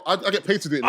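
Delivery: high laughing brag. Real, not acted. Friends joke he turned heel.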